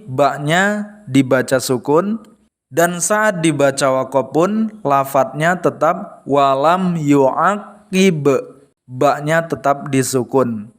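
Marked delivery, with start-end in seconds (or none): 6.3-8.3 walam yu'akib, very slow, the final b bouncing; walam yu'akib said slowly, short vowels. Shown as a demonstration. Teacher modelling the correct short walam yu'akib, very slowly. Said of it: "dibaca pendek".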